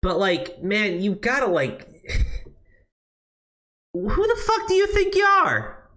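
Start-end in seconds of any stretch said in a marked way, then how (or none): 3.9-5.9 much higher, huffy mock outrage; mock indignant falsetto. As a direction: squeal it like an offended diva.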